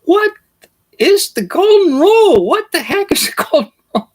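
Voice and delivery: very high, fast, baffled squeak; a little peasant confused and exasperated; puzzled peasant. Retold or imitated. Peasants down on the ground, baffled and exasperated.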